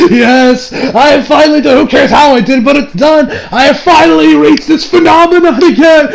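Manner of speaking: giddy madman savoring his win; gleeful villain laughing. Retold or imitated. Villain gloats about reaching the phenomenon, laughing gleefully. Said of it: "the dude is just sitting there like".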